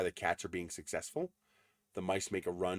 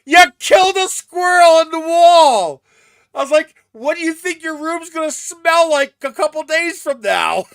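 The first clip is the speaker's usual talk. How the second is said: much higher, much louder, slowed yelling; shouting back; 21 dB louder than usual. Retold or imitated. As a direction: yell it back at him in disbelief. She yells back at dad.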